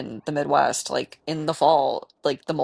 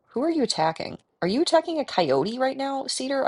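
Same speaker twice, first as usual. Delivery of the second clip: pet scolding; 10 semitones higher than usual. Real, not acted. Scolding her pet in game.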